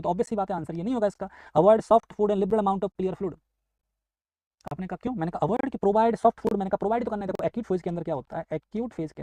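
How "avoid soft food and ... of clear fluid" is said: slow, deliberate exam-question reading. Shown as a demonstration. Teacher to students reading the quiz, slowly and deliberately. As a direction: read each word out slowly for the class. Reads answer option aloud.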